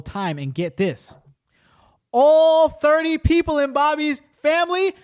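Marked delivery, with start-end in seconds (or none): 2.1-4.9 much higher, louder, slow shouted reveal; excited shout. Real, not acted. Telling friends the story's wildest twist, excited shout. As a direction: belt out the big twist.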